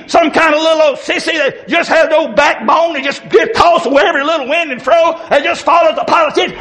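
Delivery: fiery street preacher shouting at the congregation; preaching at full force. Acted. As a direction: build the sermon into a shouting call.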